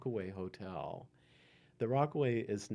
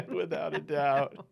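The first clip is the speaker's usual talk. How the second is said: laughing; 7 semitones higher, 9 dB louder than usual. Real, not acted. Laughing at the gutter story.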